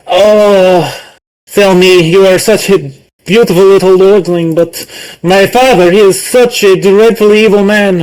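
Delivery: high, theatrical actress reading; dramatic stage lines. Acted. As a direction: ham it up like a melodramatic actress. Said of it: "saying lines, from the play".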